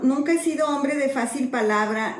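ordinary delivery

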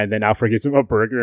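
usual speaking voice

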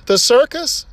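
incredulous repeat. Acted. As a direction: ask again, now in disbelief. The duck asks again.

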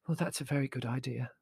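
soft, weak agreement; faint. A narrator's reading. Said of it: "said Mother faintly".

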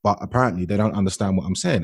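ordinary talk

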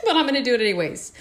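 laughing through the words; fast, laughing aside. Real, not acted. Laughs at podcast demo.

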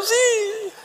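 very high, soft, drawn-out mock sob; mock crying child. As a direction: trail out a child's wail, hammed-up. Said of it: "me dijo, estaba llorando".

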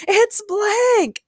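high, loud incredulous cry; shouting. A narrator's reading. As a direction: shout it out in disbelief. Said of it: "they all shouted in unison".

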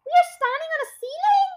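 high-pitched, loud alarmed cries; shrill-pitched panicked exclaiming; acted screaming. A narrator's reading. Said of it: "screamed Missus Twit".

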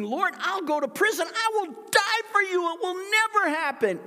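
very high, loud, fervent vow; boastful Peter. Acted. Peter vowing loyalty to Jesus, high loud fervent pledge. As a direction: swear it with all the bravado.

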